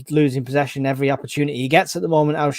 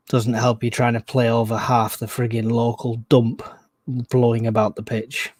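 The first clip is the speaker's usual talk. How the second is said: ordinary talk, nothing marked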